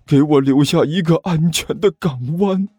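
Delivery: blubbering with gratitude, rising to a wail; tearful, overwhelmed. A narrator's reading. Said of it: "一滴泪水终于顺着武坚的眼角滴".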